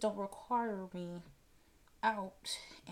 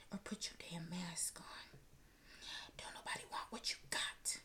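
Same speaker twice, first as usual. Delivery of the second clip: whispered scold; 7 dB quieter than usual. Real, not acted. Scolding sick people without masks.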